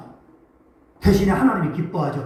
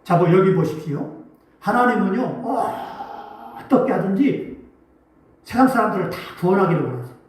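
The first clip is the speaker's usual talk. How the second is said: ordinary talk, nothing marked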